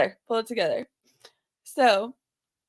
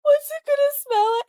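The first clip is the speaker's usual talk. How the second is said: laughing replay; 12 semitones higher, faster than usual. Real, not acted. Replaying the messages through laughs.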